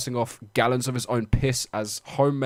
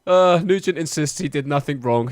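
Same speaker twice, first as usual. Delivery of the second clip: normal talking voice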